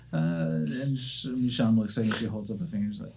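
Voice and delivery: ordinary delivery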